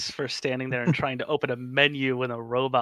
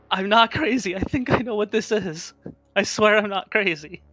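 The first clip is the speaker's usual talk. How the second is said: louder, higher insistent plea; pleading; 8 semitones higher, 7 dB louder than usual. Acted. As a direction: beg them to believe you. Pleading character insists he's sane.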